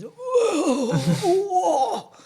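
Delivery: high, imitated wail of strain; dad's pained groan. Imitating dad getting off the couch, high pained wail. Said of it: "Dad goes to get up off the couch".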